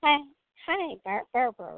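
ordinary talk, nothing marked

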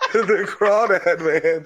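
hammed-up bayou character; crawdad man. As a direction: draw out the name in a silly Cajun voice. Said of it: "when fucking Andre does the crawdad man".

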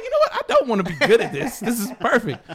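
high-pitched, laughing through the words. Retold or imitated. Comedian recalling his many takes to friends, laughing. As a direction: crack up while quoting yourself.

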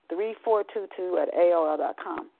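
ordinary talk

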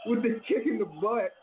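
high, sudden yelp of surprise, then rushed play-by-play; excited outburst. Real, not acted. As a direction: react like a fan seeing a sneak attack. Live reaction to wrestling video.

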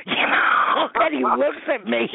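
squealing high laugh through the words. Real, not acted. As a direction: crack up mid-story. Laughing at her own story.

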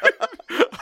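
sudden high-pitched laugh; high burst of laughter. Real, not acted. Laughs at own paranoia.

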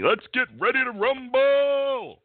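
high, loud ring-announcer call; Michael Buffer. Acted. Host calling for a ring announcer at the game, drawn-out announcer shout. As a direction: boom it out, stretching the last word long. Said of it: "Michael Buffer out there".

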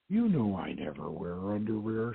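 low, soft husky voice. A narrator's reading. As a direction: drop the voice low and husky, seductive. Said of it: "she said in a husky voice".

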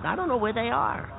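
ordinary talk

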